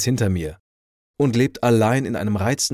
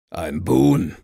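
put-on drunken bellow at a stranger; roaring drunk; 2 semitones lower, 3 dB louder than usual. A narrator's reading. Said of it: "brüllte er". (betrunkenes Brüllen)

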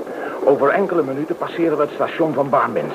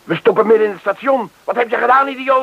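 higher, angry accusation; angry outburst; 7 semitones higher, 4 dB louder than usual. Acted. Gang leader berating the driver, angry and higher. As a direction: blame him with fury.

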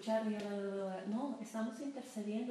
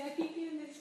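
reluctant giving; 5 semitones higher, slower than usual. Retold or imitated. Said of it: "con toda la pena decir".

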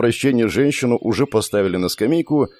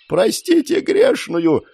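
very high, drawn-out plea; slow falsetto scream of a condemned woman; frantic screaming; slower than usual. A narrator's reading. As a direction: shriek for forgiveness. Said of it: "истошно орала та".

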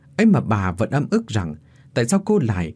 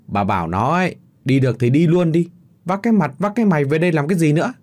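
much louder and higher, fast furious yelling; father yells at his wife to send their daughter away, furious; furious shout; 6 semitones higher, 4 dB louder than usual. A narrator's reading. Enraged father shouting that his daughter should leave, loud and fast.

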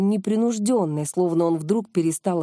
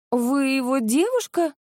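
higher, slow, let-down question; lightly voiced disappointed girl, pitched up; disappointed young woman; 6 semitones higher than usual. A narrator's reading. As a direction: ask it slowly with a touch of disappointment. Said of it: "разочарованно спросила молодая девица".

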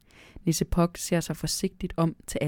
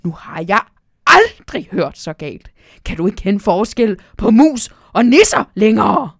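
furious scolding; 3 semitones higher, 12 dB louder than usual. Acted. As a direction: rage at being called a mouse. Elf furious at the owl.

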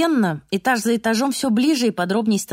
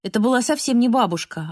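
usual speaking voice

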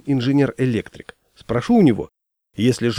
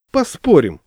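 higher, louder, fired-up agreement; азарт; 6 semitones higher, 6 dB louder than usual. A narrator's reading. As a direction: jump at the bet eagerly. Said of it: "загорелся я". (с азартом, загоревшись)

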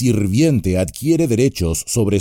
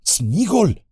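desperate; 11 semitones higher than usual. A narrator's reading. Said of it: "dijo Frodo desesperado".

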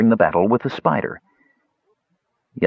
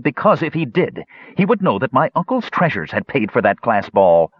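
higher, accusing taunt; 7 semitones higher than usual. A narrator's reading. Young elf accuses the dwarf, taunting. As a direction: push the accusation, pitch raised. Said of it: "the young elf taunted".

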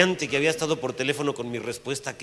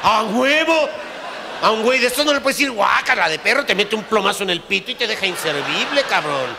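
louder, fast, laughing as he tells it; laughing shout; 6 semitones higher, 7 dB louder than usual. Real, not acted. Telling his friends the story, shouting through laughter. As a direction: roar it out between laughs. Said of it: "laugh shout".

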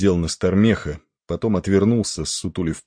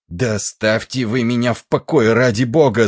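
raised, loud, exasperated cry; shouting; 5 semitones higher, 5 dB louder than usual. A narrator's reading. Stoker fending off the officer's questions, shouting. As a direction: cry out to be left alone. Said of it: "закричал кочегар".

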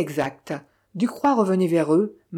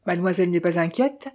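ordinary delivery, nothing marked

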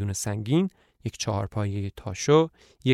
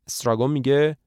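plain reading voice

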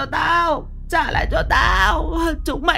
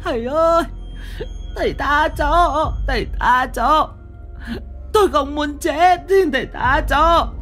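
much higher, slow crying begging for mercy; terrified wailing plea. Acted. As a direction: beg the master not to kill her, sobbing. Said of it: "rồi nằm bệt ra gào thét". (van xin khóc lóc, giọng cao vút)

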